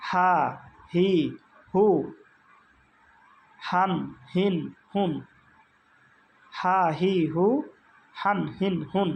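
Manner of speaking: "হা, হি, হু" on free-flowing breath; airy h drill. Shown as a demonstration. Teacher drills h with each vowel, unpressed. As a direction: keep the air running through "হান, হিন, হুন". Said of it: "বাতাস চালু".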